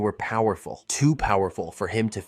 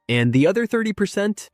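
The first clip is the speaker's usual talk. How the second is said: quizzical; 11 semitones higher than usual. Acted. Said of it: "Eighteen asked, raising an eyebrow".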